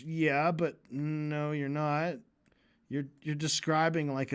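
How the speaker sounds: normal talking voice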